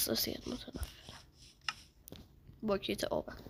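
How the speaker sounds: very soft, low unvoiced murmur; whisper. Real, not acted. Gamer complaining to himself, whispered murmur. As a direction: breathe it under your breath. Muttered aside.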